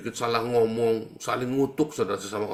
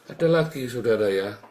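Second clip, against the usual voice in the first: grab their attention, louder; loud call-out; 8 dB louder than usual. Real, not acted. Preacher calls out a new point. (seruan keras pendeta)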